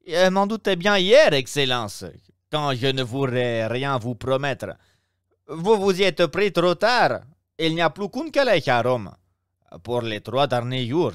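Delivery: innkeeper, fussy. Acted. Innkeeper Pastrini voiced. (aubergiste empressé, voix plus aiguë et plus forte)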